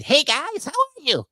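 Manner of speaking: put-on very high, boyish greeting; squeaky kid voice. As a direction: chirp it out like a young boy. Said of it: "he comes like".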